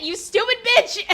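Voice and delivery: loud high shout at the screen; angry yell at the game. Real, not acted. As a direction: yell the insult in real frustration. Reacting to game character.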